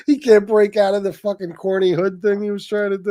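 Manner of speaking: ordinary delivery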